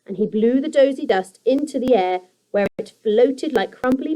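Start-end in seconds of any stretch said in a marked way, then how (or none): none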